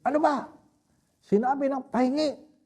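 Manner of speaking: put-on sick old priest loses patience, shouting high and sharp; angry outburst. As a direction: snap at the table for ignoring him.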